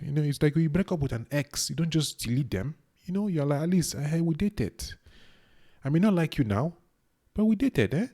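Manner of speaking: put-on high-pitched, playful Nigerian accent; attempted Nigerian accent. Vlogger teasing Nigerian viewers like an ex, attempted Nigerian accent. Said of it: "trying to talk with a Nigerian accent".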